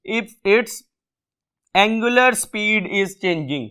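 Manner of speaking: each word drawn out for the class; slow, deliberate classroom dictation. Shown as a demonstration. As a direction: read it slowly for note-taking. Reads statement for notes.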